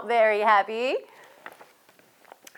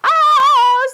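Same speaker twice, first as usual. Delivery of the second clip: high, very loud "Ah!"; startled scream; 12 dB louder than usual. Acted. Bertie caught by the sprinkler, screaming. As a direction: scream out as if suddenly drenched. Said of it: "said Bertie".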